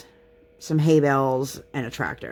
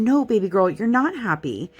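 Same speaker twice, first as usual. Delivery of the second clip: gentle mommy voice; 7 semitones higher than usual. Retold or imitated. Mother gently correcting toddler.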